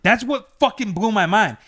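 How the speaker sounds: ordinary talk, nothing marked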